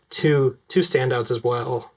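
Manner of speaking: usual speaking voice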